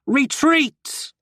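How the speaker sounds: hammed-up British battle cry; awful British accent. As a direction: bellow the retreat in a bad British accent. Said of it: "shouts in this awful British accent".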